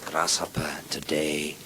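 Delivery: low, soft sage voice; hushed, solemn instruction; kung fu master. Acted. Master teaching his pupil Grasshopper, low and solemn.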